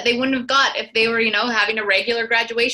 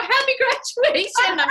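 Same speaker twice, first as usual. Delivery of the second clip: high, bright call from a passing car; cheerful call-out; 12 semitones higher than usual. Retold or imitated. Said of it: "people would drive by and go".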